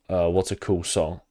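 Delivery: ordinary delivery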